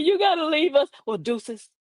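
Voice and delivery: ordinary talk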